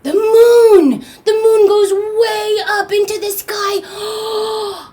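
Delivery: high, loud, stretched-out wonder; awed reveal. A narrator's reading. Storyteller revealing the moon rising, loud high wonder. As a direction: cry it out big, drawing out the words.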